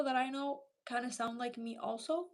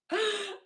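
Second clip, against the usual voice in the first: mock gasp; 8 semitones higher than usual. Reacting to kind gesture.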